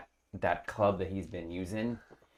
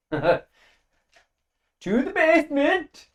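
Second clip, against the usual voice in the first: high, loud booming proclamation with a laugh; booming magic voice; 12 dB louder, slower than usual. Acted. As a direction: boom it out like a magic-amplified voice. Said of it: "Kiergan's thaumaturgific voice".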